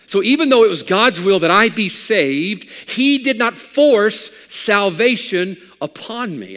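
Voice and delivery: loud, high preaching cry; full-force preaching. Acted.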